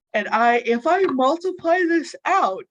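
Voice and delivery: talking through a yawn; words stretched by a yawn. Real, not acted. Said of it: "Sorry, I keep yawning".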